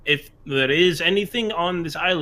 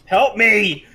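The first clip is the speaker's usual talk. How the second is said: mock cry for help; 5 semitones higher, 7 dB louder than usual.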